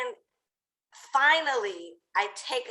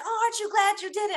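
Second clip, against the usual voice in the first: high, chirpy mock voice; gushing; 9 semitones higher, faster than usual. Staff asking afterwards if she's glad, high gushing. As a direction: imitate a perky well-wisher in a high voice.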